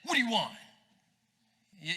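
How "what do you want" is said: snapped, louder and higher; disrespectful kid snapping at parent; rude retort. Retold or imitated. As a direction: bark it back at your dad rudely. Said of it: "It would be like this".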